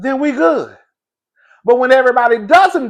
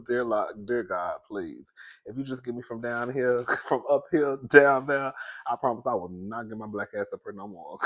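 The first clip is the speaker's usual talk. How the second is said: soft, lower, pleading prayer; drunk prayer, hushed; 6 semitones lower, 11 dB quieter than usual. Retold or imitated. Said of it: "you be telling God".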